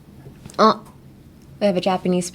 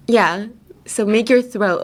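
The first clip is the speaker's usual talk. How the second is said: normal talking voice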